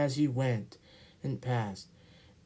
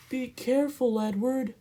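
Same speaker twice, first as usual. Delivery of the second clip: 1 coaches warning; 10 semitones higher than usual. Acted. Coaches warn Edward.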